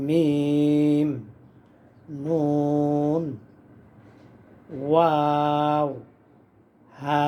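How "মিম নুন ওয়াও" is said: মিম, নুন, ওয়াও each stretched slowly; held long recitation. Shown as a demonstration. Teacher drilling long letter names for viewers. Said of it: "ওয়াও চার আলিফ".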